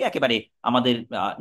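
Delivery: ordinary talk, nothing marked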